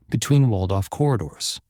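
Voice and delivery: plain reading voice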